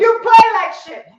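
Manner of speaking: hammed-up rude coach; mock insult. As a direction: snap it like a rude foreign coach. Mock player insulting in accent.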